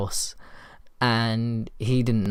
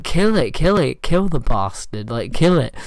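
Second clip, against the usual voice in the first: retold screaming; 2 semitones higher, 6 dB louder than usual. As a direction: imitate a panicked friend yelling orders. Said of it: "And he's just screaming".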